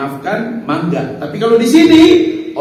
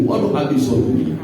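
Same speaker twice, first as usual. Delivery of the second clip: usual speaking voice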